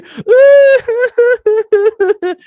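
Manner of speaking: loud, very high wavering fake sob; mock wailing. Girl flipping out after smoking, mock wail. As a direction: burst into an exaggerated shrieking cry. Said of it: "She starts crying".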